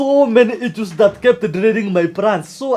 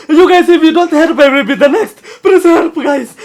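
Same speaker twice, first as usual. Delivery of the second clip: desperate pleading; 5 semitones higher, 5 dB louder than usual. Acted. Begging friends for help.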